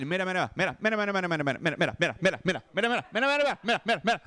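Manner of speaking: "mira" fired off over and over in bursts; rapid-fire repetition. Retold or imitated. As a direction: nag for attention with quick, high "mira". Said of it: "you would say it a lot".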